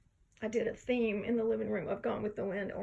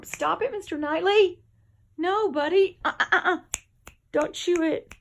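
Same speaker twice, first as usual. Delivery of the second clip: higher and louder, telling the cat off; scolding the cat; 6 semitones higher, 6 dB louder than usual. Real, not acted. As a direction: stop the cat chewing the tree, loud and high. Scolding cat chewing tree.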